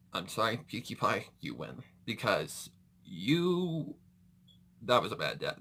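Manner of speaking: normal talking voice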